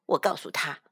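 ordinary delivery

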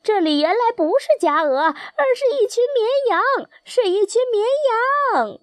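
high mock-startled goose cry; exclaiming in alarm. A narrator's reading. Wild geese teasing the tame geese as sheep, high startled exclaiming. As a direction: rise into a very high, astonished call. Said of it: "他们惊呼道".

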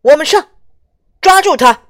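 rally the troops to charge; rallying shout. Acted. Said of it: "警长说".

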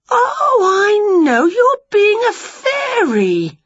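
high, loud, slow discovery; light up with the idea; delighted realisation. Acted. Witch guessing her cat is a fairy, high and delighted.

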